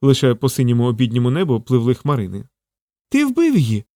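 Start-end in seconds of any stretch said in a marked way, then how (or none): 3.1-3.8 high-pitched horrified squirrel; aghast little squirrel exclaiming; horrified cry. A narrator's reading. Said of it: "нажахано викрикнула пампушка".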